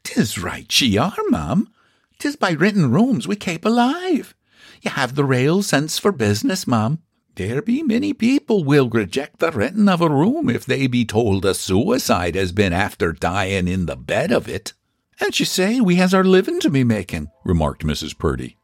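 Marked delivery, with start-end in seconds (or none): 0.0-14.7 put-on high Irish-accented neighbour; a nosy Irish neighbour, impressed; Irish gossip voice. Mrs McCool speaks. 15.2-17.3 put-on high Irish-accented landlady; a proud Irish housekeeper boasting. Mrs Purdy speaks.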